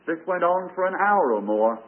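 ordinary delivery

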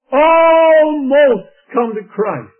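"Almost" held long, high and loud; drawn-out preaching cry. Real, not acted. Preacher applying the story to souls, "Almost" cried out long. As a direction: ring out "Almost" as a slow, loud lament. Preacher turns the phrase into lesson.

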